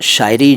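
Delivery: शायरी with s for sh, "saayri"; common mispronunciation. Retold or imitated. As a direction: say शायरी wrongly, opening on a plain s. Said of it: "कई लोग शायरी कह देते हैं". (शायरी को गलत ढंग से, श की जगह स)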